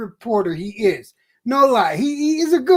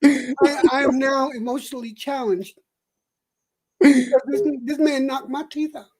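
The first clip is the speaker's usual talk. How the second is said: Stossel with loose dentures; 5 semitones lower than usual. Pretend. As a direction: lisp like his teeth are loose. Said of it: "let me see if I can act this out".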